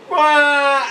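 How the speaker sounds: high, loud mock baby wail into a laugh; imitating baby cry. Teacher imitating a crying baby to show breathing, mock high wail. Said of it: "when they start crying, they go".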